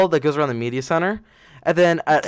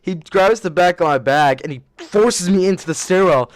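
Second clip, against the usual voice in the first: ordinary talk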